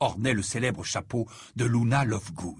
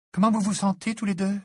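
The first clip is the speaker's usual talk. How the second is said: timid question in a higher voice; higher, hesitant character voice; 8 semitones higher, 3 dB louder than usual. A narrator's reading. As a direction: ask tentatively, pitched up. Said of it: "demanda-t-elle timidement".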